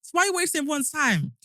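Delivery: high exasperated question; indignant. Real, not acted. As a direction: demand it in disbelief. Indignant reaction to story.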